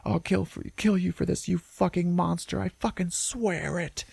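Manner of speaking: high-pitched, acted tearful vow; crying. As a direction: swear revenge through mock sobs. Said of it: "He's just fucking crying".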